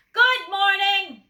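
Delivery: loud, slow, high bellow. A narrator's reading. Grocer bellowing good morning at a shy customer. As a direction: boom it across the shop. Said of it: "bellowed the grocer".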